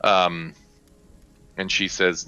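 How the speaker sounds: ordinary delivery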